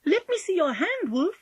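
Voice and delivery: put-on high, willing child; obliging. A narrator's reading. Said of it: "said Polly obligingly".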